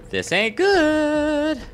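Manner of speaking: very high, slow singsong worry; mock dread. Gamer out of ammo, high slow mock worry. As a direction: draw it out in a high fretful voice.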